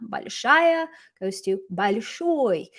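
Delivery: "Большая" and "большой" slowly, stressing the "ша" and "шой"; shown word stress. Shown as a demonstration. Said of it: "the stress is on the final syllable".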